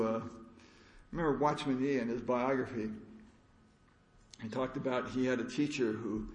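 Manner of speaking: ordinary talk, nothing marked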